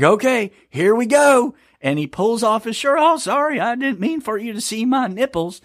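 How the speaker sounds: high, creaky mock old man; a high mock old man saying sorry to the camera; old guy voice. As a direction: play him as a doddering old man apologizing. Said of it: "I'm doing an old guy voice".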